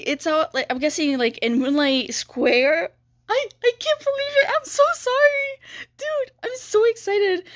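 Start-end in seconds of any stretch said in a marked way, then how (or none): 3.3-7.4 high, fast squeal of pure excitement; excited squeal. Real, not acted. Squealing to viewers about the Halloween update, much higher. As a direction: gush over the new update.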